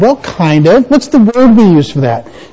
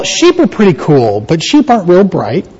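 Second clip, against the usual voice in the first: normal talking voice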